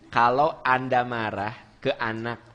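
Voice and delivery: ordinary delivery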